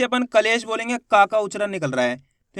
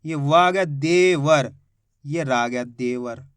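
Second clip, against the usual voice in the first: slow, deliberate syllable by syllable; slow spelling-out; 6 semitones lower, slower than usual. Shown as a demonstration. Teacher slowly spells they were out for children. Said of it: "वर बोलेंगे".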